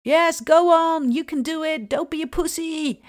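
imitating the group leader's pushy drill orders; drill instructor. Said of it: "strenge drill instructor-achtige woorden".

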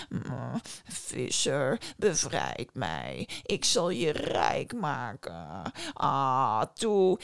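low, slow, honeyed coaxing; sweet-talking djinn. Acted. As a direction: purr promises to win him over. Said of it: "begon de djinn poeslief beloftes te maken". (lage, poeslieve djinnstem)

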